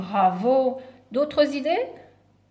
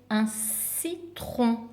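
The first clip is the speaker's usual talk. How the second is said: "citron" drawn out slowly as ci, tron; slow, softer "citron", broken after "ci"; syllable by syllable; 5 dB quieter, much slower than usual. Shown as a demonstration. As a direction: stretch and separate both syllables of "citron". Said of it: "commencent par la syllabe si".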